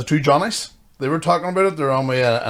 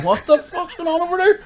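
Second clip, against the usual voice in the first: much higher, puzzled mock call across the road; baffled neighbour. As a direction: play the confused neighbour staring over. Neighbours' baffled reaction voiced.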